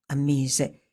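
ordinary delivery, nothing marked

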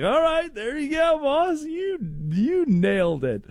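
high hammed-up praise; mock praise. Host mock-praising Jose for his answer, high and hammed-up. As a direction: pat him on the back in a big high voice.

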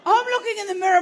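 ordinary talk, nothing marked

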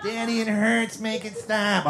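put-on high whimpering child voice; mock whimper like a hurt little girl; whiny little sister. Little sister whimpering to daddy, high mock whine. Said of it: "sister crying to dad".

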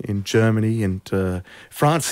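usual speaking voice

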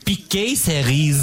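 cherry picker said as Piquet Cerise in a French accent; slow, hammed-up French accent. Shown as a demonstration. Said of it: "Can you say cherry picker with a French accent?".

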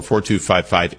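usual speaking voice

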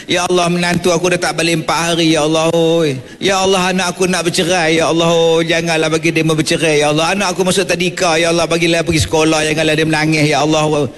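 fast, rattled-off pleading prayer; mock worldly prayer. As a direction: rattle off petty wishes like a fussy worshipper. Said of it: "cuba baca doa". (doa mengejek, laju dan merayu)

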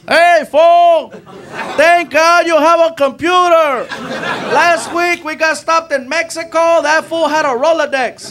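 high-pitched excited passenger voice; passenger calling out. Acted. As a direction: call out high, stretching the words. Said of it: "All I hear from the front seat is this".

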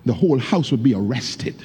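usual speaking voice

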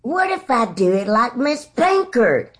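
high-pitched imitation of a grade school teacher; playful high teacher impression; Miss Pinkard. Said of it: "I went in with that accent".

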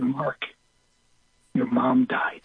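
soft, halting delivery; grave news. Retold or imitated. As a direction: break the news gently, pausing after the name. Breaking news of mother's death.

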